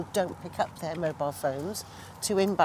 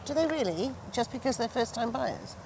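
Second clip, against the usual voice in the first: high, pointed disbelief at his claim; incredulous questioning; 6 semitones higher, 4 dB quieter than usual. Retold or imitated. Claire challenging an agent about first-time buyers, incredulous. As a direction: question him as if he's missed the obvious.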